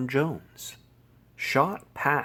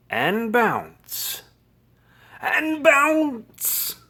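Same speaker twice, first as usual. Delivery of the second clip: bouncy narration rising to a high peak; building excitement; 11 semitones higher, 4 dB louder, much slower than usual. A narrator's reading. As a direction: climb higher and louder with each bounce. Repeated bouncing, building each time.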